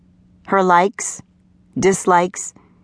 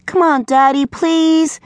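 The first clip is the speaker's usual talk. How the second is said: put-on high, wheedling child's plea; pleading daughter; 10 semitones higher, slower than usual. A narrator's reading. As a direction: beg Daddy to let you off. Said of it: "She tried to talk her father".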